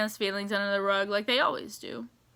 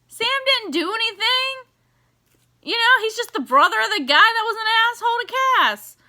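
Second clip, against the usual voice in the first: higher, louder, fast defensive rant; worked-up rant; 11 semitones higher, 5 dB louder than usual. Real, not acted. Fan defending Sam to viewers, worked-up and louder. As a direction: stick up for Sam, getting heated.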